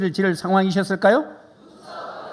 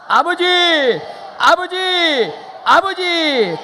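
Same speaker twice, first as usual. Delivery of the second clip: high, loud calling out to the Father; three full-voiced calls of 아버지; loud cry; 10 semitones higher, 4 dB louder, much slower than usual. Real, not acted. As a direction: cry out to God as loud as you can. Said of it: "큰 소리로 아버지를 세 번 불러보겠습니다".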